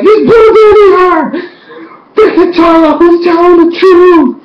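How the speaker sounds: very high, loud squealing; panicked fan outburst. Retold or imitated. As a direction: shriek it out in disbelief. Acts out her panicked outburst.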